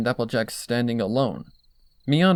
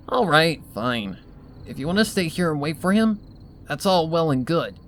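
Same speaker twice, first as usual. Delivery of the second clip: higher, louder brash Rainbow Dash voice; tomboyish insistence; 5 semitones higher, 3 dB louder than usual. Acted. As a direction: brashly overrule her friend.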